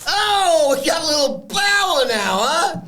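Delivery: high mock Italian-American taunt; Tony impression. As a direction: needle him like a wiseguy. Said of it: "Tony says".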